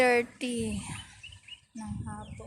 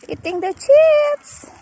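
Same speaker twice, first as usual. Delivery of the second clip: cooing at the cat; 8 dB louder than usual. Real, not acted. Praising the cat for eating, in very high, loud baby-talk.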